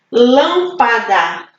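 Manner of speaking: Lâmpada slowly split LÂM, PA, DA; slow Lâmpada, each syllable set apart. Shown as a demonstration. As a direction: say Lâmpada in three separate beats. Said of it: "Três sílabas".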